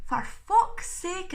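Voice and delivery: slow, loud, high exasperated curse; annoyed with herself for fumbling; exasperated swear. Real, not acted. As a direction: drag out the frustration. Frustrated at stumbling on word.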